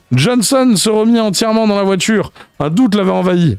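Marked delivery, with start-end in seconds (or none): none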